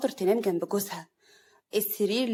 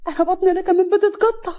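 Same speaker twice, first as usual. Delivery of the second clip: high, loud wail of bellyache; acted pain, shrieking upward; pained accusation; 8 semitones higher, 7 dB louder than usual. A narrator's reading. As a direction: accuse her through stomach cramps. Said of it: "سعاد هي كمان ماسكة بطنها".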